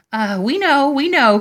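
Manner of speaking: high, loud panicked insistence; crying out. A narrator's reading. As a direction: blurt it out desperately. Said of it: "cried those two sisters".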